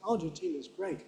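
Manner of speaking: soft "Argentina's great", no beat on TI; downgraded middle stress. Shown as a demonstration. Teacher giving a natural example to class, downgraded stress. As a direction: beats on AR and GREAT only, quietly. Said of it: "Very, very common downgrading".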